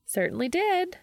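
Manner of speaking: put-on sing-song breezy young woman brushing off worry; upbeat Charlie. Narrator voices Charlie.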